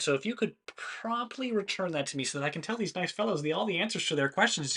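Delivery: lightly voiced chipper robot Ted; cheerful Ted voice. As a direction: ask sweetly for the memory back. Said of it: "Ted will go".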